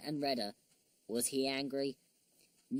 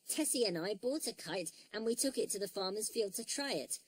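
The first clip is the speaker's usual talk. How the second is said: put-on higher, soft storybook child character; lightly voiced young hero owning up; Noddy; 5 semitones higher, 6 dB quieter than usual. A narrator's reading. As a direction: explain earnestly in a higher little voice.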